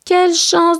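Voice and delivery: high-pitched exclamation, lightly acted fright; trembling with fear. Boy robbed by three thieves, high-pitched mild fright. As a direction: cry out, mildly scared. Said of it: "tremblant de peur".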